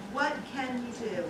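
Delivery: normal talking voice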